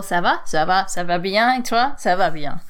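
quick French ça va exchange; chatty French back-and-forth; French greeting. Retold or imitated. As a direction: rattle off the French small talk. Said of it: "in French the".

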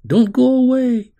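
high, pleading cry; a child crying out to someone leaving; desperate cry. Acted. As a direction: call out in distress. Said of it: "her own cry".

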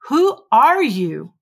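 usual speaking voice

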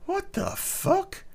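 high, slow, stunned disbelief; stunned what the fuck. Retold or imitated. Friends react as the ex arrives, stunned high squeak. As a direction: squeak it out in shock.

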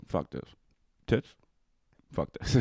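low, soft mock grumble; imitated grumbling dad. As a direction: give up on it under your breath, as dad. Imitates dad giving up on film.

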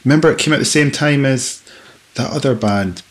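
ordinary talk

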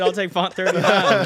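mocking his own choice; laughing quote.

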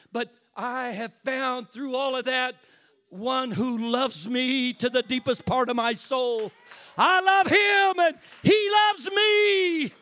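slow, loud and high, shouted preaching; preaching at full force. Acted. Preacher proclaiming God's love to the church, shouting slowly. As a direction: hammer each word out to the congregation.

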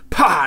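loud, sharp "pah" for a smack; explosive imitated smack; imitated slap noise. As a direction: burst out a loud mock slap sound. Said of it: "And just slapped her".

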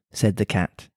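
plain reading voice

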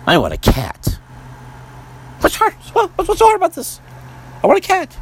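high-pitched whiny protester; mock tantrum over wanting a cat; whiny cat demand. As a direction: whine for a cat like a spoiled child.

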